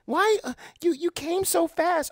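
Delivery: high, put-on woman's voice; mock girlfriend. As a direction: play the let-down girlfriend, high and breathy-free. Acted woman in bedroom skit.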